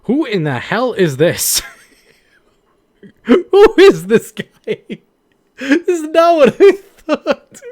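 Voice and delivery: high, loud and slow laughing disbelief; shocked laughing. Real, not acted. Streamer reacting to a stranger's photo, shocked and laughing.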